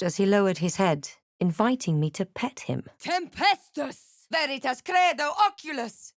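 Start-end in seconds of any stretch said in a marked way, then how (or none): 3.0-4.3 drawn out, much higher; an incantation summoning a tempest; spell incantation. Acted. Casting a storm spell. 4.3-6.1 incantation. Acted. Casting a spell.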